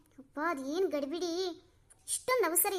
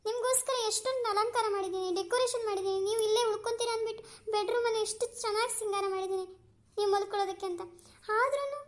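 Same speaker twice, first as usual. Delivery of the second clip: higher, pouting complaint; whiny sulking protest; 4 semitones higher than usual. Acted. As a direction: whine at him like a spoiled lover. Mistress protests he leaves early.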